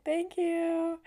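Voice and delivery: high, drawn-out mock-sweet thanks; grateful thank-you. Stuck driver thanking the man who pushed, high grateful call. Acted thanks to helpful stranger.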